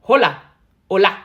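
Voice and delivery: very loud, short greeting; calling out. Pretend. Someone retrying a Spanish greeting, calling out. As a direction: fire it out loudly, twice.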